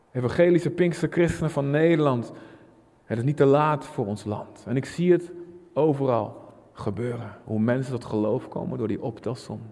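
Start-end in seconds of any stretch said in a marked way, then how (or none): none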